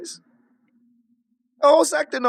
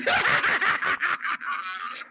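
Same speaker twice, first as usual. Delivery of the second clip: high, soft cackle; gleeful cackle; high laugh; 8 semitones higher, 6 dB quieter than usual. Real, not acted. Laughing at his scheme.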